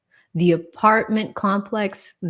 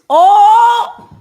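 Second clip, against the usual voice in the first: loud, much higher shocked "Oh!"; outraged gasp; 13 semitones higher, 13 dB louder than usual. Real, not acted. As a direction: explode in disbelief. Outraged at four-month delay.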